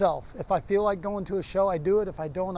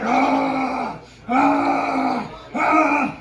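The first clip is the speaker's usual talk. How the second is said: drawn-out loud animal growl; 5 semitones higher, 7 dB louder, much slower than usual. Retold or imitated. Wrestler working himself up behind the bench, loud growl. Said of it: "I would slowly start to fucking growl".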